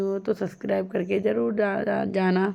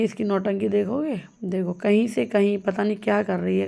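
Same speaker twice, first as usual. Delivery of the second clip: ordinary talk, nothing marked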